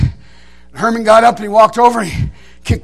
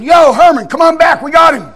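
raised, higher calling-out voice; retold triumphant holler; 5 semitones higher, 4 dB louder than usual. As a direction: call your hunting partner back from a distance. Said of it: "And I hollered, said".

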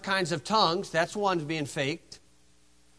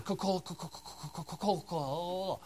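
mock tongues babble; 10 dB quieter than usual. Mocking fake tongues by repetition.

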